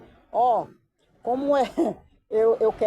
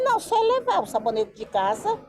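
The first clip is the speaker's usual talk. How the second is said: retold easygoing local man; 11 semitones higher than usual. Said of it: "ele era desse jeito".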